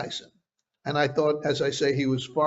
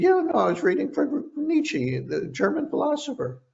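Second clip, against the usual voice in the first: Mike Tyson impression; 11 semitones higher than usual. Interviewer imitating Tyson showing him a book, high voice. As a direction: lift the voice way up to mimic the boxer.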